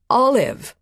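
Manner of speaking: loud, ringing call of her name; mother calling her daughter's name loud and clear; strong clear call. A narrator's reading. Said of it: "strong and clear ... loud enough to drown out".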